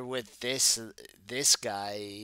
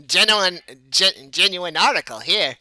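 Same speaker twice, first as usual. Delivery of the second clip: loud, higher showman's pitch; effusive show manager; 6 semitones higher, 14 dB louder than usual. Acted. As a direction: tout it like a promoter.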